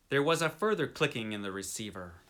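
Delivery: ordinary delivery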